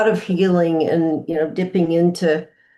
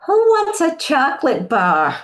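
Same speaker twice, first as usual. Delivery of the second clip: higher, lightly voiced old New Orleans grandma accent; 8 semitones higher than usual. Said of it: "in an old New Orleans accent".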